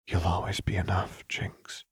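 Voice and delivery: soft, hushed reassurance; quiet loving whisper; tender whisper. Acted. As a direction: whisper comfort into her hair. Lux comforting Jinx softly.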